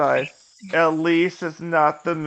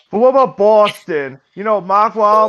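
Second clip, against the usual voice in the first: dropped R's, Boston style; Boston accent; 3 semitones higher, 4 dB louder than usual. Acted. Player pitching Boston over Jersey, in a Boston accent. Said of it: "you're not gonna do the accent?".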